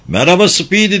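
loud, high greeting call; shouting. A narrator's reading. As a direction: hail him from far off. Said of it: "diye haykırdı Donovan". (haykırarak)

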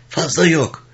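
high-pitched, envious put-down. A narrator's reading. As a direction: belittle it, failing to hide envy. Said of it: "sesindeki haseti gizleyememişti". (hasetle, tiz bir sesle)

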